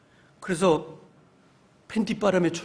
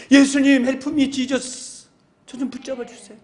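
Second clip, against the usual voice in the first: pleading cry to Jesus; 7 semitones higher, 6 dB louder, slower than usual. Pretend. Congregant crying out to Jesus.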